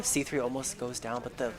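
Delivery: ordinary delivery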